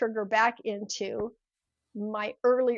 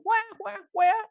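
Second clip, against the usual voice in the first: high mock infant wail; playful high baby cry; baby crying imitation; 9 semitones higher, 3 dB louder, slower than usual. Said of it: "they go".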